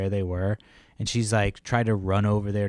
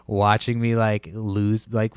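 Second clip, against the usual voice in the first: normal talking voice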